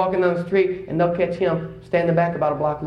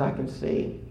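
soft, imitated mumble of wonder; retold mumble; 3 semitones lower, 8 dB quieter than usual. Man newly able to see after surgery, mumbling softly. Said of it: "simply mumbled the words".

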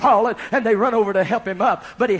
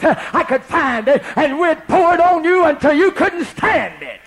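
rising preaching fervor; 6 semitones higher, 5 dB louder than usual. Retold or imitated. Preacher's quote builds up.

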